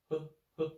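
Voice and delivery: he clipped short and slight; short light neutral tone. Shown as a demonstration. Teacher contrasting the short neutral tone with the long first tone. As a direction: tap the he quickly and lightly. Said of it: "This one is like".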